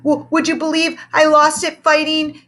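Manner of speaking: high, loud, stuttered excuse; stammering. A narrator's reading. A scared teacher stammering an excuse to his old teacher, high. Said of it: "he stammered".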